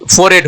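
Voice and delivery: "फोरहेड" as "for-ed", h silent; merged pronunciation. Shown as a demonstration. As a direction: merge "फोरहेड" into one word without h. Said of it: "ये तीनों को हम क्या कर रहे हैं". ("फोरहेड" मिलाकर फ़ोरेड बोलें)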